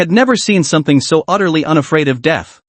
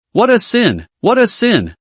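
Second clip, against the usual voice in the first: shriek; 6 semitones higher than usual. A narrator's reading. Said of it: "the piercing shriek echoed".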